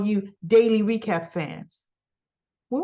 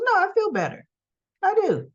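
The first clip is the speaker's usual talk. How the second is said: imitated woman insisting; 9 semitones higher than usual. Woman's reply voiced high, retold.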